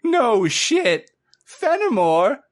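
high squeaky voice, thrilled; delighted otter shipwright. Acted. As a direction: squeal out a happy welcome. Otter recognizes famous captain.

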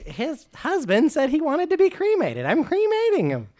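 very high, insistent argument; indignant retort. Acted. Muldar defends burning the body, high retort. Muldar argues back about the cremation.

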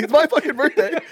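high, laughing mock tantrum; mock bratty kid. Birthday boy defending his frosting, mock bratty protest. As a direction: whine it like a spoiled birthday boy.

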